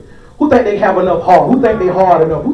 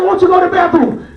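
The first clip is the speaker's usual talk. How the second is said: annoyed dad; 8 semitones higher than usual. Retold or imitated. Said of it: "I be like".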